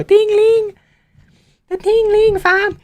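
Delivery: very high, drawn-out Toronto slang voice; mock Toronto accent. Shown as a demonstration. Streamer showing pots rhyming down the stairs in mock Toronto accent. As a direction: put on a squeaky Toronto accent. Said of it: "and they go".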